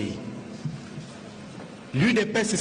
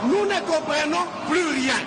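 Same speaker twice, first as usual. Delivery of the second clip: higher, emphatic repeat of "Nous ne comprenons plus rien"; exasperated outcry; 8 semitones higher than usual. Real, not acted. Deputy pressing the Assembly president, exasperated higher repeat. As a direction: hit it again with rising exasperation.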